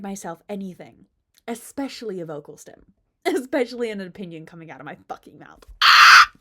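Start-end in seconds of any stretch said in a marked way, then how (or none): 5.8-6.3 very loud, abrupt "Ah!"; sudden yelp. Real, not acted. Vlogger demonstrating her unfiltered vocal stim, sudden yelp. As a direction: blurt out a loud noise.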